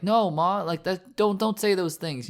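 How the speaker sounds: put-on childlike pleading. Son pleads with his mother not to say it, childlike pleading. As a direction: plead like a scared kid breaking down. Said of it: "kind of breaking down like a child and being like".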